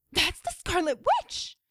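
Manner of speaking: high, slow, excited blurt; blurted excitement. Acted. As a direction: burst out in awe. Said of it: "Kamala burst out".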